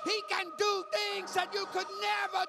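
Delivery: high, intense preaching; impassioned preaching. Acted. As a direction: press the promise home to the congregation. Preaching climax, high and strong.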